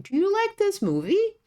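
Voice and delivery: "Do you like this movie?" pitched high, rising on "like" and "movie"; question intonation. Shown as a demonstration. Teacher demonstrating intonation to learners, high rising. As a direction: sing the question upward, high. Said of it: "Attention to the intonation".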